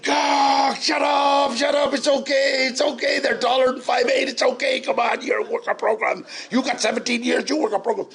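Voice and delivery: loud high shouted self-talk; shouting at himself. Retold or imitated. Calming himself among the men he'd robbed, loud high shouting. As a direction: yell it at yourself, half panicked.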